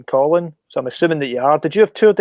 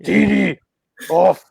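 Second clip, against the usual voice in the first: a touch of an angry dad, a bit louder; aggressive father; 2 semitones higher, 3 dB louder, slower than usual. Retold or imitated. Joking about French class, a mildly aggressive French father. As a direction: bark the name like a cross father, half in jest. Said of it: "It's aggressive".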